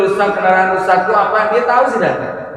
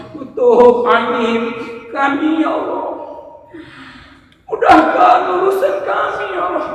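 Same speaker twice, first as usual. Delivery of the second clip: fervent prayer, building; 8 semitones higher, slower than usual. Acted. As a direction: pray from a hush up to a cry to Allah. Leads a tearful prayer.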